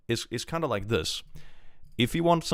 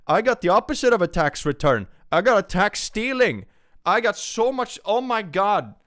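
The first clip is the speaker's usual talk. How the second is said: high, loud indignant wail; exasperated outburst; 11 semitones higher, 6 dB louder than usual. Real, not acted. Streamer complains to chat of tax stealing, exasperated. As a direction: escalate into aggrieved, comic outrage. Complains about losing money to taxes.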